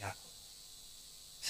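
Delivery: breathy whisper, very slow, fading out; the name barely escapes through tears; whispered tearful name. Retold or imitated. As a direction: Jacob whispering his name, broken. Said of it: "అప్పుడు ఏడుస్తా అన్నాడు".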